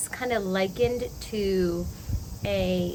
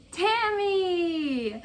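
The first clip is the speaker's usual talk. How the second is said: excited shout; 9 semitones higher than usual. Real, not acted. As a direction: call the name out with delight. Finds out who sent it.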